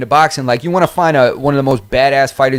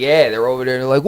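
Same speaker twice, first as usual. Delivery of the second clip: ordinary delivery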